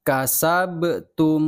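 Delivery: "Kasabtum" very slow, the b released with a bounce, "kasa-be-tum"; bouncing ba. Shown as a demonstration. Teacher models the bouncing ba for pupils, very slow. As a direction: let the b in "Kasabtum" rebound. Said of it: "Bukan kasabtum".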